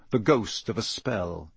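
usual speaking voice

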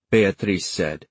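ordinary delivery

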